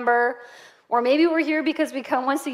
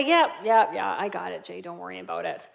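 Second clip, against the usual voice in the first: quick trailing-off reassurance, dropping much softer; dismissive brush-off; 6 semitones lower, 3 dB quieter than usual. Retold or imitated. Wife waving off her husband's warning, dismissive. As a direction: brush off the worry, voice sinking away.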